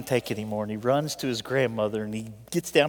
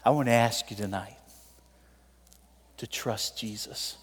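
usual speaking voice

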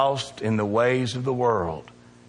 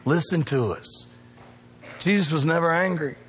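usual speaking voice

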